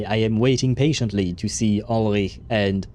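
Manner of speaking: ordinary delivery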